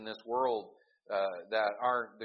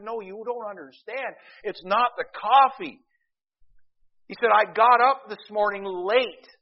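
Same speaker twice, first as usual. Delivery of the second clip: distraught little guy; 11 semitones higher, 14 dB louder than usual. Retold or imitated. Little guy's sad tale in joke.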